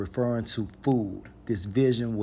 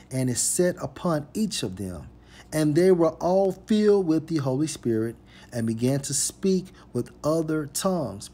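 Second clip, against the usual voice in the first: preacher's reading building to a louder, higher proclamation; emphatic scripture reading; 4 semitones higher, 4 dB louder than usual. Acted. Preacher reading about the Holy Spirit to listeners, emphatic and swelling. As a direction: proclaim the verse, swelling in the middle.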